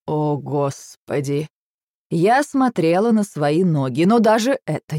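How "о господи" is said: slow, low sigh of despair; drawn-out, resigned appeal; weary exasperation. A narrator's reading. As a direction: groan it, worn out by him. Exasperated by the husband's threats.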